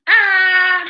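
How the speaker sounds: "ah" held high and level, slow; high flat first tone. Shown as a demonstration. Teacher demonstrating first tone to students, high and level. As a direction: sing "ah" high and flat like the dentist asks. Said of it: "that's the first tone".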